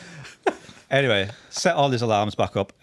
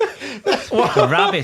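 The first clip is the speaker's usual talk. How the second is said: nonchalant; 6 dB louder, much slower than usual. Retold or imitated. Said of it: "So nonchalantly".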